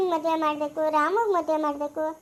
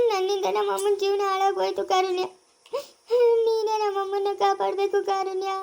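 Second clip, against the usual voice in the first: sobbing plea with gasps between phrases; tearful pleading. Acted. Daughter begging Karunya to save her mother, crying. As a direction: cry and beg, blaming yourself. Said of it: "ಯಾಕ್ ಅಳ್ತಾ ಇದೀಯಾ".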